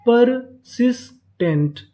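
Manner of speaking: Persistent slowly split as पर, सिस, tent, stepping down; syllable by syllable. Shown as a demonstration. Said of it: "तो पूरी meaning क्या बनी". (Persistent को रुक-रुककर तीन हिस्सों में)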